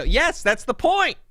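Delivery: high, loud gloating; triumphant. Real, not acted. Gloating at Dave, high loud triumph. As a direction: crow over your win.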